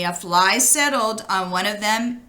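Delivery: plain reading voice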